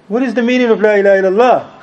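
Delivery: high, quick quizzing voice; pointed challenge. Retold or imitated. Brother testing the preacher on the kalima, pointed challenge. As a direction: fire the question like an examiner.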